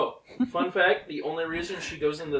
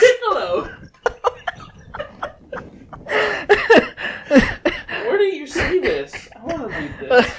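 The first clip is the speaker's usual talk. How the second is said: laughing; 11 dB louder, slower than usual. Real, not acted. Laughing at shared storyboard.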